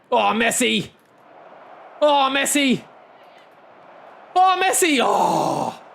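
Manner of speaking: high, loud cries at the match; excited shouting. Real, not acted. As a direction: shout it out, pitch climbing with each cry. Goal chance shouts while gaming.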